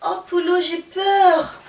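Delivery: high, alarmed voice; frightened cry. Acted. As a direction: point and cry out in fear. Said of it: "s'écrie".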